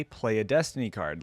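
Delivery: usual speaking voice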